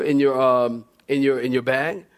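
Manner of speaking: usual speaking voice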